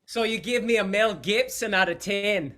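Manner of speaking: high, loud mock Scottish; put-on Scottish accent. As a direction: fish for praise in a hammed-up Scottish accent. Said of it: "What is my Scottish accent grade?".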